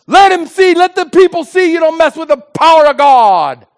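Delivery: high, loud, furious plea to God; shouted plea. Retold or imitated. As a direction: call down lightning at the top of your lungs. Shouted silent prayer for vengeance.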